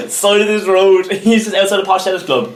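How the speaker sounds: high, laughing storytelling; really cracking up mid-story. Real, not acted. Teller cracking up at own story.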